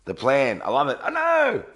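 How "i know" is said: high, loud exclamation; excited. Real, not acted. Answering chat about being up early, high and excited. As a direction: shout it out high and pleased.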